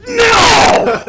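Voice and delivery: a high, loud, exasperated "No!"; mock shout. Rocket yelling at Groot over the button, a loud mock shout. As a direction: yell it like a fed-up Rocket. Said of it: "And he's like".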